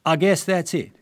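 an Englishman's put-on American Southern accent. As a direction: read the US airman in a Southern accent. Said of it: "forgive my southern accent".

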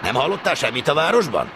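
loud, high insistent question; pressing question. A narrator's reading. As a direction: demand the news eagerly. Stationmaster pressing the trainee. (sürgető, hangos kérdés)

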